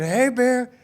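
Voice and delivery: high-pitched repeated holler; screamed bear warning. Retold or imitated. Rider retelling how they scared off a bear, screaming. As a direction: call out high and hard, twice over. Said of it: "So I just start screaming".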